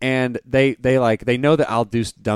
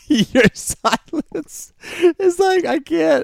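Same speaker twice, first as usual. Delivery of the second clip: cracking up, high helpless laughter; real laughing; 5 dB louder, much slower than usual. Real, not acted. Comedian losing his deadpan at co-host's silence, real laughing. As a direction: squeeze the words out through laughter. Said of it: "I wanna do this deadpan, and I can't".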